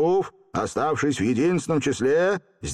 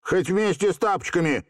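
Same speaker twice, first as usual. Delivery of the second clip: brave; 8 semitones higher than usual. A narrator's reading. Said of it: "мужественно отвечал".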